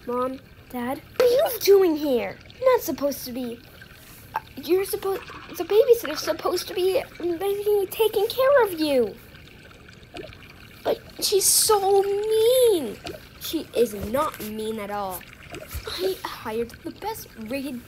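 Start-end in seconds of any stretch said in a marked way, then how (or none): 1.2-13.0 loud shocked scolding; upset shouting. Acted. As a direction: raise the voice in alarm. Parents find kids, kids complain.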